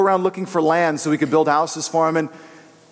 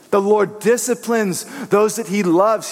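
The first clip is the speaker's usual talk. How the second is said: ordinary talk, nothing marked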